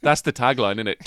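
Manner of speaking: ordinary talk, nothing marked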